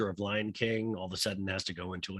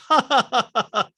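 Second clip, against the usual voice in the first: loud laugh; 13 semitones higher, 9 dB louder than usual. Retold or imitated. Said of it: "And Jeff goes".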